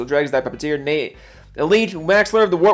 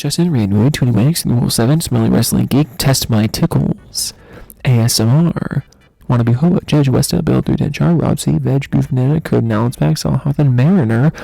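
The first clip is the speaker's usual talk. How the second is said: lower, louder put-on voice; put-on deep voice; 3 semitones lower, 5 dB louder than usual. Streamer reading the subscriber list in a lightly low, loud voice. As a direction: boom the names out in a silly low voice.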